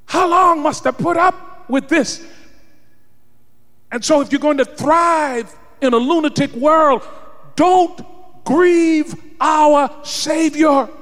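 fiery preacher's climax; preaching at full force. Acted. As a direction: lift the congregation with a rising cry.

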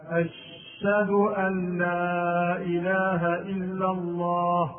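chanted adhan with أشهد sung as "ash-shadu"; dropped ha, doubled shin. Shown as a demonstration. Teacher mimicking muezzins' mistake, "ash-shadu" for أشهد. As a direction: intone slowly, swallowing the h of أشهد and doubling its sh. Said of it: "حذف الهاء وتشديد الشين".

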